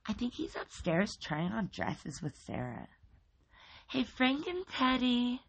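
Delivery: high cheerful lady calling; cheery calling out. A narrator's reading. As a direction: sing out upstairs brightly. Miss Hatchetface cheerily calls upstairs.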